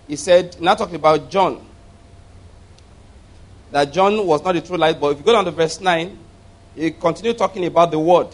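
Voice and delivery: usual speaking voice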